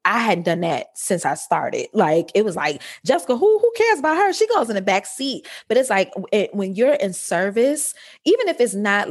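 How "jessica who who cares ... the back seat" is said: high, mocking brush-off of herself; dismissive inner voice. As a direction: toss her own needs aside like they don't matter. Inner voice dismissing her own needs.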